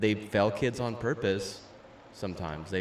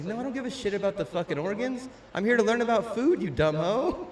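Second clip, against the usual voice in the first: indignant retort; 10 semitones higher, 5 dB louder than usual. Retold or imitated. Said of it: "It's like".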